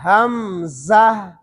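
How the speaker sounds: ہمزہ drawn out very slowly, the ha kept audible; ہمزہ stretched, with voice and breath flowing through the ha; slow careful hamza. Shown as a demonstration. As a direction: sound out ہمزہ slowly, letter by letter. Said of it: "زا کے بعد ہا کو ظاہر کرنا ہے".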